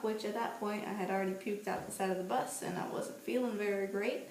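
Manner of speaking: ordinary talk, nothing marked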